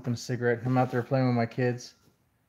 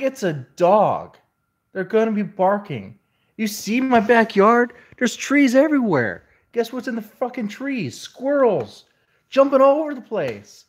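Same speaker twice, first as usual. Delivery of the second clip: exasperated rant; 8 semitones higher, 6 dB louder than usual. Retold or imitated. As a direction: vent at the officer, fed up. Retold rant at the police.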